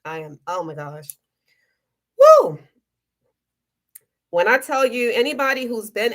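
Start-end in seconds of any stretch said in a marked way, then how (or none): 0.0-1.2 choked up. Real, not acted. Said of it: "I can get emotional". 2.2-2.6 high, loud whoop of release; sudden cheer shaking off the tears; joyful woo. Real, not acted. Joyful outburst after getting emotional.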